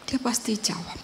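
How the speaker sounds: whispered repeat of "Dia pasti jawab"; whispered echo. Shown as a demonstration. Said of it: "repeat, whispered".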